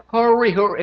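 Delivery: high, loud try at American hurry; attempted American accent. Shown as a demonstration. Brit learning to say the chorus, attempted American accent. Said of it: "It doesn't sound right when I say it".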